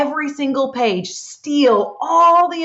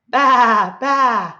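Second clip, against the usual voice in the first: mock sheep bleat, a bit louder; 4 dB louder than usual. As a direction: bleat like a little sheep for the kids.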